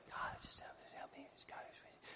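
desperate whispered plea; whispered prayer. Retold or imitated. Hannah weeping silently in the tabernacle, whispered prayer. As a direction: breathe the prayer with no voice. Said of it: "She's crying and like".

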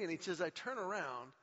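usual speaking voice

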